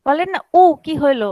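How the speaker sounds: normal talking voice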